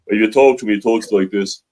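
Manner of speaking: thick working Dublin brogue, 'loike dis'; strong Dublin accent. Shown as a demonstration. Guest demonstrates an actor's accent to host, strong Dublin accent. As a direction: imitate a broad Dublin accent, vowels rounded out. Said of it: "he's got a very strong Dublin accent".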